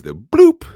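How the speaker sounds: high, loud bloop sound; imitated pluck. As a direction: pop it out like a plucked string.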